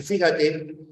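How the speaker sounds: usual speaking voice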